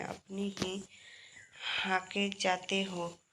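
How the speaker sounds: normal talking voice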